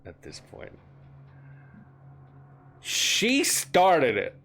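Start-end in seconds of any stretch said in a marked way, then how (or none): 2.8-4.4 outraged interjection. Acted. Game master as Venara accusing her rival, outraged high shouting. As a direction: wail the accusations at full volume. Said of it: "Venara interjects".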